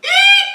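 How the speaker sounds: very high, loud eek; high shriek. Acted. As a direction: shriek like you saw a mouse. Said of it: "shouted the elephant".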